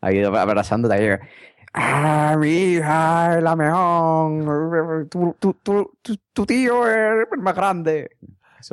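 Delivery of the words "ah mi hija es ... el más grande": put-on high, slow drunken slur; blackout drunk. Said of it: "ve al suegro o a la suegra ahí borracho perdido".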